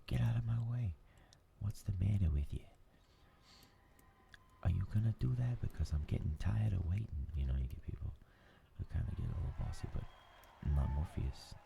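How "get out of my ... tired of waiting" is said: higher, pushy Northeastern accent, mock impatience; aggressive Northeastern accent. Mimicking bossy Northeasterners in line, aggressive Northeastern accent. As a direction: play an impatient Northeastern New Yorker shoving past. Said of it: "they have a more aggressive accent".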